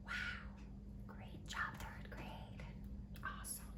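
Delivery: soft whispered "wow, great job"; hushed excited whisper; whispered praise. Real, not acted. Teacher praises class in whisper.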